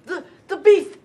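high, breathless frightened stutter; pitched high, tripping over words; panicked stammer. Acted. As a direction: stammer in terror. Stammering after seeing beast.

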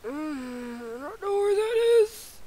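whimper, then whine it high. Pretend. Lost player not knowing the place, whimpering.

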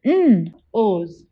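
N-O's split into onset and long vowel; segmented. Shown as a demonstration. Teacher sounding out N-O's for the class. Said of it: "Can you say it out loud?".

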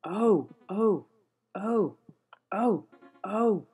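high, loud repeated oh's; alarmed oh's. Real, not acted. Losing at the cricket game, crying out each time. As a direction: yelp at each mishap.

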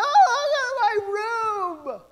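high, slow, amazed gasp; mother seeing her new room, overwhelmed; awed mom. Retold or imitated. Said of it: "they're like".

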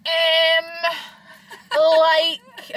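high, drawn-out mock filler words; mocking mum's imitation. Mum mimicking her daughter's speech, exaggerated drawl. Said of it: "Mum just says to me all the time".

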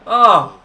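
loud, high "oh" of frustration; frustrated cry. Real, not acted. Player groaning at a missed chance, loud frustrated cry. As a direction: cry out loudly at the miss.

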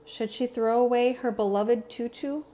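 plain reading voice

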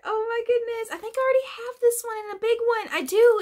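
high, fast, breathless gush; excited gushing. Real, not acted. Gushing to viewers over the dragon. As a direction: babble with delight.

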